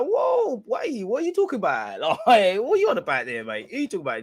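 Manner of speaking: high-pitched, hammed-up disbelief; baffled outburst. Fan re-enacting bafflement at his Cockney mate, exaggerated disbelief.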